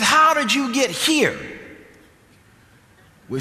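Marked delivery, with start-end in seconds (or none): 0.0-1.4 high-pitched, slow astonished question; retold surprise. Woman questions the visiting preachers, retold astonishment. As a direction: imitate a woman amazed at her visitors.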